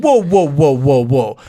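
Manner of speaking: loud startled whoa-whoa, stepping back; mock alarm, hands up; retold startled protest. Parent reacting to blaming kid.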